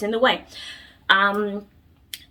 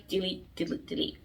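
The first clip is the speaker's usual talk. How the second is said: much softer, trailing repeated delete; quiet delete; 13 dB quieter than usual. Real, not acted. Vlogger quietly repeating advice to delete an ex, soft trailing. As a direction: let the deletes fade to almost nothing. Telling viewers to delete him.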